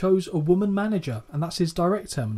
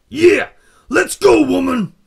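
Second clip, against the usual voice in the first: much higher, loud promo yell; Macho Man shout; 8 semitones higher, 12 dB louder, slower than usual. Retold or imitated. Imitating Savage storming off, loud hammed-up shout. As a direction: yell it like a pumped-up wrestler. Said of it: "apologies for the impression".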